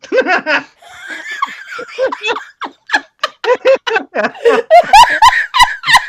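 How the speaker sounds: long, high, shrieking laughter; real uncontrollable high cackling; helpless laughing fit. Real, not acted. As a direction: lose it completely laughing, loudly.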